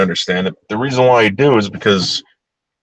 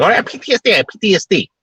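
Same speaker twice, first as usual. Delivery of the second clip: high mocking whine, much higher; mocking whiny women; 10 semitones higher, faster than usual. Host mocking women who claim PTSD, high whiny parody. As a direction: parody them as whiny and overdramatic. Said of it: "stupid women running around saying".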